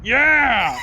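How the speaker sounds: a loud, high whoop of delight; excited yell. Real, not acted. Gamer cheering the space cannon shot with a loud whoop.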